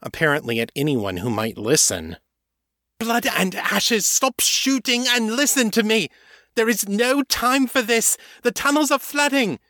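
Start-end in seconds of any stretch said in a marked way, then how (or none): 3.0-8.1 very high, frantic shout of anger and fear; vampire yelling at the shooting men to stop; angry terrified shouting. Acted. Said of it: "her voice full of anger and terror". 8.4-9.6 very high, frantic cry of warning; angry terrified shout. Acted. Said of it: "her voice full of anger and terror".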